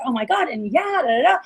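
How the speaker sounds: mock animated phone chatter, quick reactions. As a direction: imitate a lively receptionist reacting. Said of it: "They're very animated".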